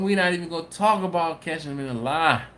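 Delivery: ordinary delivery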